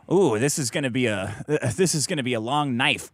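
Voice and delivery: low, deliberate cop quip; TV cop one-liner. Acted. TV cop delivering a crime scene pun, low and deliberate. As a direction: drop low and pause before the punchline. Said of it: "then the cop comes in".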